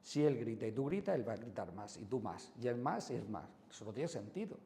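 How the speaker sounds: normal talking voice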